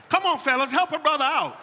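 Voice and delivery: loud, high-pitched plea; rallying appeal. Real, not acted. Husband appealing to the fellas for support, rallying loudly. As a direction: call out to the crowd for backup.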